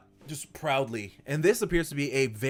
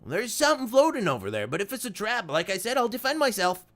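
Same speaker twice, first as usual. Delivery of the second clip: higher, cautious gnome voice; wary old gnome; 7 semitones higher than usual. Acted. Gimbal spotting something floating, wary and higher. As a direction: peer out warily, ready to defend. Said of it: "And he's like".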